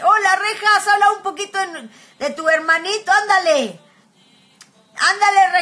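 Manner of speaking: loud, high call across the room. Real, not acted. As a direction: call Rejas over, coaxing. Calling out to Rejas across the room.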